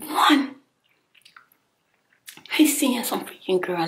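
punched out, loud; emphatic. Real, not acted. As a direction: count it off hard. Counting off his offenses.

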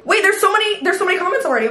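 thrilled surprise; giddy; excited. Real, not acted. Excited at many comments.

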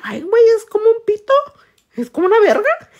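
high, loud mock alarm; retold shocked squeal. Telling viewers what she told her, high loud mock shock. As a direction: squeal it like a scandalized joke.

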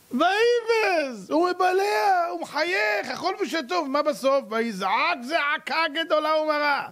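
high, sustained dramatic crowing; mock elation. Preacher showing how good the wicked's start looks, mock elation.

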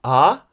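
AH swept upward; short, loud AH rising like a question. Shown as a demonstration. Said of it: "we can call it a rising tone".

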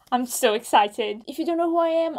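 normal talking voice

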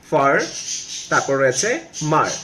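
"ফার" and "মার" said as separate syllables. Shown as a demonstration. Teacher splitting farmer for learners, syllable by syllable. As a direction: break it into "ফার", pause, "মার". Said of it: "একটু ভেঙে পড়ি".

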